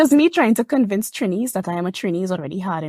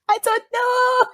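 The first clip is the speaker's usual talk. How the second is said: higher, laughing whine of confusion; mock despair; 12 semitones higher, much slower than usual. Confused student asked to dictate, laughing whine. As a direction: wail it out half-laughing, slow.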